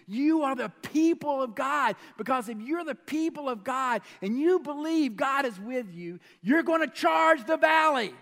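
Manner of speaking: high, building, impassioned; David pleading with his brothers; urgent plea. Retold or imitated. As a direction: build to a rallying cry. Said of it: "David's like".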